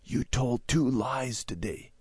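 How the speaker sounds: slow, hushed accusation; soft stage whisper, drawn out. A narrator's reading. Sister privately accusing Randy in the car, whispering. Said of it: "Debbie poked Randy and whispered".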